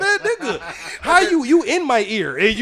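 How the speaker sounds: high, laughing mock complaint; retold exasperation. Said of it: "I'm like".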